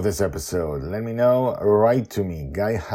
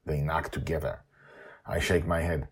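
ordinary delivery, nothing marked

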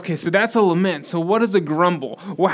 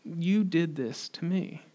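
hushed, slow accusation; quietly accuse, word by slow word; grumbling accusation; 10 dB quieter, slower than usual. Acted. Showing grumblers pointing fingers at someone, hushed accusation. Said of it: "grumbling looks like this".

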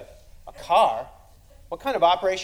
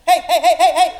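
much higher, louder yelping heys; yelping hey louder, more like a dog; more doglike barking; 13 semitones higher, 12 dB louder than usual. Acted. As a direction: bark hey like a frantic dog. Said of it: "acted more like a Spanish dog".

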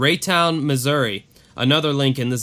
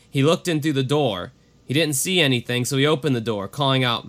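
ordinary talk

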